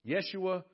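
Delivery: "Yeshua." said yeh-SHOO-ah; "Yeshua." clearly in Aramaic, yeh-shoo-ah; Aramaic name. Shown as a demonstration. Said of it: "In Aramaic".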